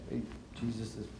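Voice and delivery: low, hushed, small voice; timid mumble. Retold or imitated. Andrew murmuring softly to Jesus, hushed. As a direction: mumble it shyly like a boy bringing bread.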